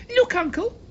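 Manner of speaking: high, loud hysterical cry; boy screaming hysterically at his uncle. A narrator's reading. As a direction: shriek it in a panic. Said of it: "Simon screamed, unnecessarily hysterically".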